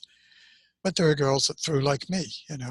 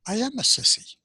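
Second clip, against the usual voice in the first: put-on much higher, small boy's admission; childlike acceptance; 10 semitones higher, 4 dB louder than usual. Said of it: "So I said".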